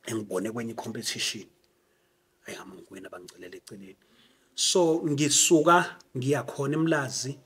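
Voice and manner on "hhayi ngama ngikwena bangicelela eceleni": fast unvoiced whisper. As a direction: whisper it quickly aside. Whispered aside in story.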